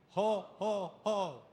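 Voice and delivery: loud ho ho ho from the belly; leading the standing crowd in laughter yoga, loud belly ho. Shown as a demonstration. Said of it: "we're gonna start down here".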